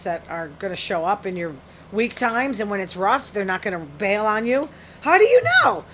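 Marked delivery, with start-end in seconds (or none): 5.0-5.8 high, loud exasperated question; exasperated cry. Real, not acted. Challenging advice to pick loyal people, exasperated cry. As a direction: cry out the question, pitch jumping right up.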